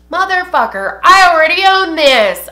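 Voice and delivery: loud, high exasperated yell; retold pissed-off outburst. Vlogger retelling a duplicate purchase, pissed off. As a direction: relive the furious realization at full volume. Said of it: "I was so pissed".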